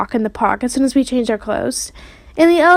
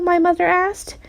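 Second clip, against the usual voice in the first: usual speaking voice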